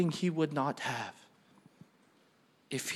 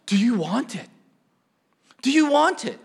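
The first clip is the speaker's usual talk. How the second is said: astonished question, rising higher and louder on the repeat; hammed-up shocked parent; 8 semitones higher, 8 dB louder than usual. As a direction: ask in acted disbelief, then more excitedly. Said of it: "I was so shocked at this".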